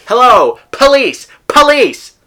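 calling the police in mock alarm. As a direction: yell for the police as a joke.

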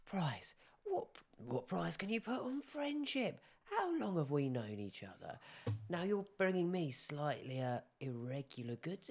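high, put-on wheedling trader; dwarf merchant voice. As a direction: play the sly dwarf haggling over a friend. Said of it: "turn to you and goes".

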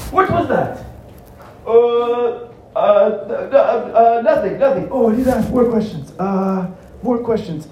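high-pitched, panicky stammer; flustered Joe, stalling. Acted.

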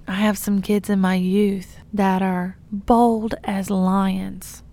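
usual speaking voice

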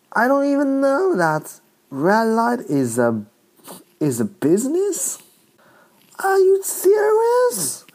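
high-pitched disbelief, climbing to a squeal; incredulous. Real, not acted. Comedian learning red lights are a business, incredulous. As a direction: stretch the final question way up high, astonished.

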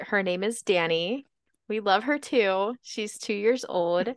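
normal talking voice

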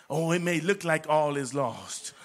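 usual speaking voice

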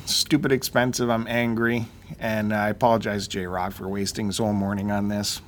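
ordinary delivery

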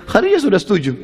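bursting to share good news; delighted news. Acted. Matchmaker announcing good news.

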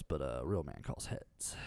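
low, slow macho tough-guy line. Acted. Celebrity mocking himself to a reporter, in a low, slow macho voice. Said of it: "So now it's all of a sudden it's like".

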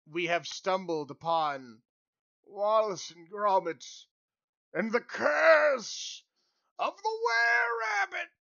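slow, rising to a high shouted title; announcer building to a shout; dramatic build. Pretend. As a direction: build to a trailer-voice climax.

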